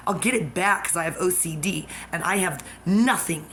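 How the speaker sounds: loud, hard angry talk; angry rant. Real, not acted. Accusing her stalker, loud and angry. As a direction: press every accusation hard.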